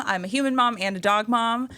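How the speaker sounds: ordinary talk, nothing marked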